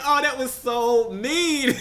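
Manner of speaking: high, laughing disbelief; squealing through giggles. Real, not acted. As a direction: laugh it out, scandalized. Everybody reacts to mean fake-out.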